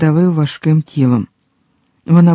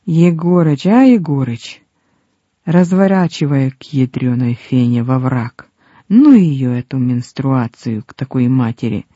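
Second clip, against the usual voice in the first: usual speaking voice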